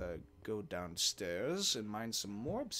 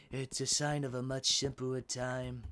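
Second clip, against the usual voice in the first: lightly voiced Donald Trump; mild Trump impression; 2 semitones higher than usual. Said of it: "Why did I do a Donald Trump voice?".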